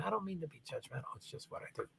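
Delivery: soft unvoiced whisper, almost to himself; whispered aside. Real, not acted. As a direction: drop to a breathy half-apology under the breath. Muttered aside after apology.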